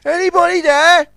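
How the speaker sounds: high, loud call over the wreck. A narrator's reading. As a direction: call out to whoever's there. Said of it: "he called".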